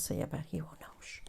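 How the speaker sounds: conspiratorial hushed whisper. Real, not acted. Whispering so her husband won't find out. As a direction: lean in and whisper the secret. Secret about husband's toes.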